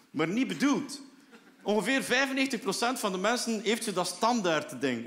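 usual speaking voice

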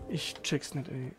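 usual speaking voice

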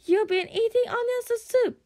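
high squeaky dragon accusation; anxious dragon. A narrator's reading. Toothless accuses Hiccup of eating soup, high and anxious. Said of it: "said Toothless anxiously".

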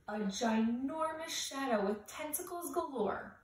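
ordinary delivery

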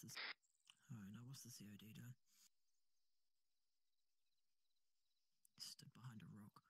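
hushed whisper, fast. Real, not acted. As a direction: whisper so the enemy can't hear. Whispering during stealth.

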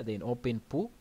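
ordinary talk

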